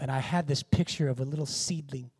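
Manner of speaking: ordinary talk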